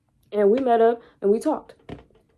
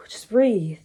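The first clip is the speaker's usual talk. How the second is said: sigh, just breathe. Retold or imitated. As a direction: exhale and calm yourself down. Calming herself after crying.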